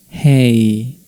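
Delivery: HEY held on a level tone; drawn-out, level HEY; flat intonation. Shown as a demonstration. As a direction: keep HEY even and loud. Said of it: "and flat".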